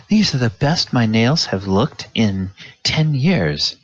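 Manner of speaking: high, sugary voice raving over her nails; gushing half-elf lady. Acted. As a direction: coo at the manicurist like she's a miracle worker.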